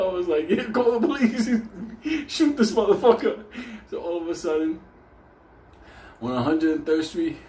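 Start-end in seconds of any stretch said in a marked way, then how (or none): 0.5-3.4 high loud laughing yell; laughing shout. Retold or imitated. Joking reply to passengers.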